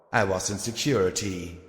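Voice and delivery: usual speaking voice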